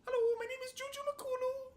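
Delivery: put-on high Canadian accent voice; JJ McCullough impression. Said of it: "my J J McCullough impression".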